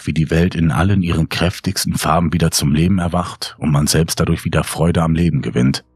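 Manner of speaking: usual speaking voice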